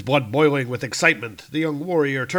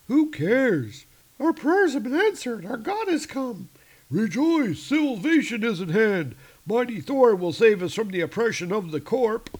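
much higher, excited bystanders; high crowd voices; 10 semitones higher than usual. Acted. Subway crowd worships the new god, high and awed. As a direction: squeak out the worshippers' praise.

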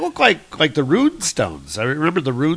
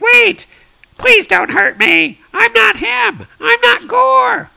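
very high, loud squeal; panicked cartoon creature voice; pleading squid creature; 8 dB louder than usual. Green squid guy begging Thor for mercy, shrieking plea.

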